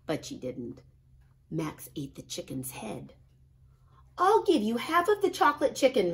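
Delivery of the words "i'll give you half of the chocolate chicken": higher, louder, fast coaxing yell. A narrator's reading. Ruby yelling an offer to hiding Max, higher, louder and fast. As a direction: yell out a bargain to lure him out. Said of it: "yelled Ruby".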